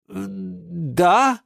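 high, hesitant questioning yes; unsure. A narrator's reading. Юра backs down before the geranium, unsure. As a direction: agree with wavering confidence. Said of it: "но уже не так уверенно".

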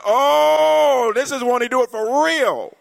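high ecstatic church shout; joyful shout. Retold or imitated. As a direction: shout it like the whole church is on its feet. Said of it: "you're gonna be like".

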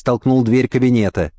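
usual speaking voice

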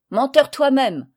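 loud, high angry retort; Léon hurls the insult back, furious. Acted. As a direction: throw the insult back in a rage. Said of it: "répondit Léon furieux".

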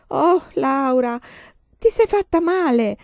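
high, loud, drawn-out cry of alarm; alarmed exclamation. A narrator's reading. Carrie cries out to hurt Laura, alarmed and loud. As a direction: call out fearing she's badly hurt. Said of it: "esclamò Carrie".

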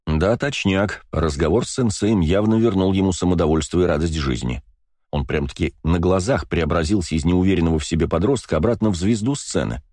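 usual speaking voice

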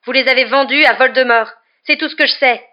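loud, high outraged shout; angry accusation. A narrator's reading. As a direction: hurl the accusation at him.

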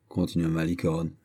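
ordinary delivery

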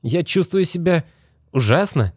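put-on high, weak voice of a dazed boy; groggy young boy, just woken; boy's voice. A narrator's reading.

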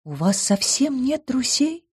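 high, slow, soft horrified gasp; be genuinely shocked, softly; sincerely horrified. A narrator's reading. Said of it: "искренне ужаснулась она".

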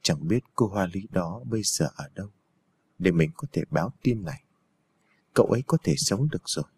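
low, quiet sickbed talk; concerned old monk. A narrator's reading.

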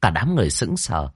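ordinary delivery

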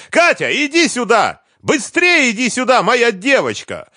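very high, loud screamed summons and accusation; enraged mother yelling at full pitch; hysterical shouting. A narrator's reading. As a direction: shriek for the child to come and witness. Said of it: "продолжала кричать Лиза".

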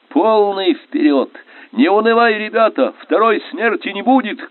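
high, rousing command cry; rally the crew at a shout; urgent battle call. A narrator's reading.

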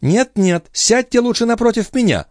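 high, urgent female voice; insistent high-pitched protest; woman intervening. A narrator's reading. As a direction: step in quickly, pitched very high. Woman intervenes to calm quarrel.

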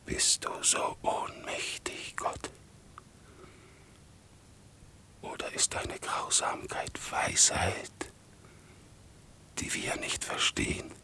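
voiceless whisper to God; whispered, halting question; hushed questioning. Acted. Accuser turns to hushed questioning.

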